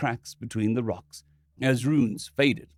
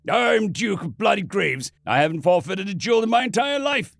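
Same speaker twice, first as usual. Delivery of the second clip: raging; 7 semitones higher, 3 dB louder than usual. Acted. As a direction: rage at the priest. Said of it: "he raged".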